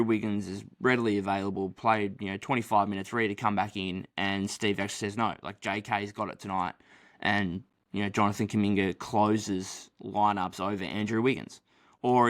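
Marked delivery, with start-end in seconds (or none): none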